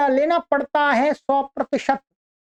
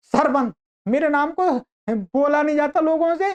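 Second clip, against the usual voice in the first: normal talking voice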